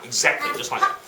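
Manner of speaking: ordinary delivery, nothing marked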